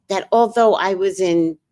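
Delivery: usual speaking voice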